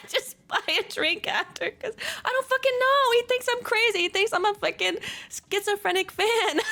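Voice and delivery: high, laughing through the words; squeaky-high amused disbelief; laughing exasperation. Real, not acted. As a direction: vent helplessly through laughter. Laughing at own embarrassing story.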